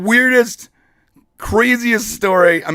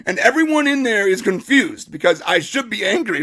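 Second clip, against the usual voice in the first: usual speaking voice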